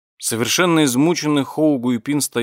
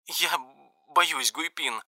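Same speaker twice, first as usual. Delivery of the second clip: tearful; 6 semitones higher, 6 dB quieter, slower than usual. A narrator's reading. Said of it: "И она заплакала".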